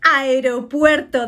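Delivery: ordinary talk, nothing marked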